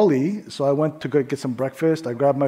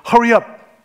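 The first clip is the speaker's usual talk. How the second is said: barked, louder and higher; rude demand; 7 semitones higher, 6 dB louder than usual. Retold or imitated. Rude customer snapping at the cashier, loud demand. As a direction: snap at the cashier to hurry. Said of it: "and she says".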